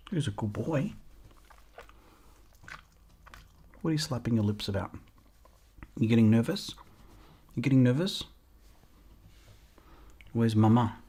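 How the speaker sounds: softly reassuring a nervous puppy; soft puppy talk. Real, not acted.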